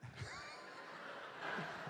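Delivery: high, breathy cackle; high laugh. Real, not acted. Comedian laughing at his own [name] story, high laugh. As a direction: burst out laughing.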